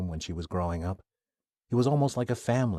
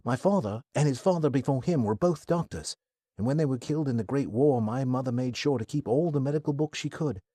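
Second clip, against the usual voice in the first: put-on higher, earnest modest young man; 4 semitones higher than usual. Kai telling Athena of his doctor family, earnest. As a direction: explain humbly but eager.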